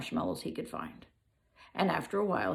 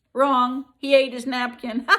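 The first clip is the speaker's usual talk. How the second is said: loud, higher gotcha reveal; spring the answer on them; teasing the child with a loud reveal; loud punchline; 5 semitones higher, 9 dB louder than usual. A narrator's reading. Teller springs the punchline on listener.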